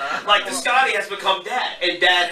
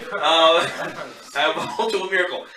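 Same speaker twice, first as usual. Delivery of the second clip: usual speaking voice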